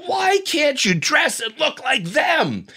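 mocking a wife shouting at her husband; berating shout. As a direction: scold him in front of the whole room. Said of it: "And she points across the room".

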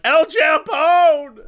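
high slow shout of the name. Retold or imitated. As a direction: yell the name stretched out. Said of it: "You're saying it wrong".

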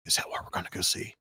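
hushed whisper from the front seat; whispering daughter. Retold or imitated. Daughter whispering to dad in the car, hushed. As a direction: lean in and whisper the question. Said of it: "and she goes".

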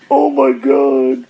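high, slow, drawn-out mock squeal; a friend in the background, imitated with a high, drawn-out squeal. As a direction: imitate a freaked-out girlfriend stretching it out. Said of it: "one chick's like".

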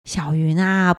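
ordinary delivery

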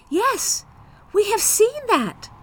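put-on high, loud children's voices; excited children. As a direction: chime in like eager kids. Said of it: "said both children".

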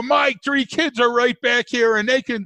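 high, worked-up dad brag; lightly voiced boastful dad. Dad bragging about his kids to another dad, mock boastful.

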